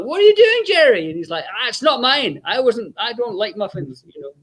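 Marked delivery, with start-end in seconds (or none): 0.1-1.1 high, louder indignant call; mock trainer scolding. Trainer catches Jerry eating. 1.5-4.1 higher, sheepish denial fading softer; guilty denial. Retold or imitated. Jerry caught, denying.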